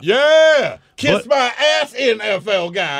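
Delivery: high, loud mock taunt; mock jeering at the NFL guy. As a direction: jeer it gleefully.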